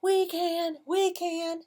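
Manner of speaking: high, bouncy chant; eager helpers' answer. Acted. Animals answer the stuck duck, high chanted reply. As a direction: chime in high and loud for kids to join. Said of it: "what do you think they say".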